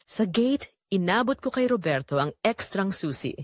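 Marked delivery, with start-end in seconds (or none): none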